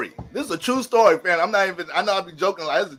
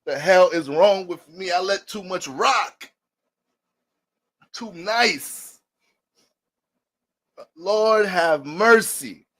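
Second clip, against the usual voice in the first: usual speaking voice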